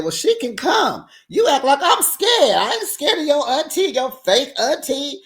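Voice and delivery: high, loud angry shouting; heated yell. Retold or imitated. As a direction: shout back, defiant. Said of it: "Evelyn said".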